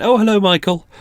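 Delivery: high-pitched imitation of a friendly greeting; mock cheery greeting. Coffee shop staff greet a regular customer, mock cheery.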